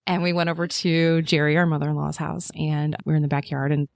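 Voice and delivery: ordinary talk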